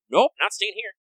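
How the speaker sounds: acting out a firm no; curt mock refusal. Teller voices her refusing to stay, mock defiant.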